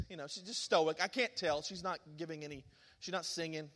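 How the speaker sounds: ordinary delivery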